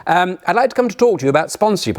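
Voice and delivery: mock upper-class English voice on the phone; plummy English accent. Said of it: "I'm gonna have a go at his accent".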